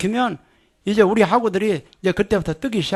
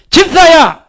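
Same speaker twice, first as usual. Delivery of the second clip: loud high shout of the name; 9 semitones higher, 11 dB louder, slower than usual. Shown as a demonstration. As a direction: call out with full force. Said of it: "내가 집사야 하면".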